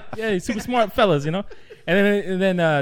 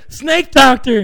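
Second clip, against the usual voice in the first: group answer; 7 semitones higher, 8 dB louder, much slower than usual. Retold or imitated. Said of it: "they all say".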